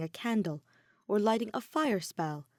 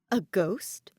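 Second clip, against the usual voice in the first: puzzled question; 4 dB louder than usual. Acted. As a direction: ask it half amused. Edelgard quirks a brow.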